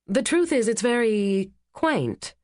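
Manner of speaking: slow, tactful and measured; carefully chosen words. A narrator's reading. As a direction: pick each word with care. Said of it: "Kate chose her words carefully".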